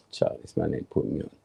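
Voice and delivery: quick unvoiced whisper under the breath; whispered aside. Benched player muttering to himself about the manager, whispered. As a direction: hiss it to yourself, fast and soft.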